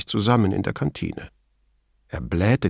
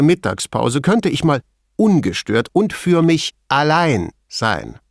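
higher, emphatic lab chief; Veltrini, self-important; 6 semitones higher than usual. A narrator's reading. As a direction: complain emphatically, pitched up. (wichtigtuerischer Laborchef, hohe Stimme)

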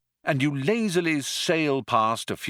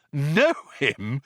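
higher, incredulous exclamation; exclaiming; 6 semitones higher, 3 dB louder than usual. A narrator's reading. Ford answers Arthur about knowing Zaphod, exclaiming. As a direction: exclaim it on a raised pitch. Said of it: "exclaimed Ford".

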